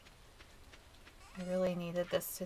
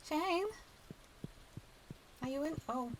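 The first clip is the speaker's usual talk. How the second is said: higher, calling out a name; 8 semitones higher, 4 dB quieter than usual. Pretend. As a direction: call through the door. Calling to neighbor's door.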